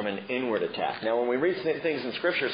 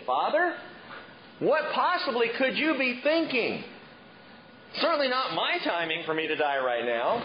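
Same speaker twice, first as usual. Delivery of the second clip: exasperated complaint to God; 11 semitones higher than usual. Pretend. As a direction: whine at the Lord in disbelief. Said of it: "I'd be going".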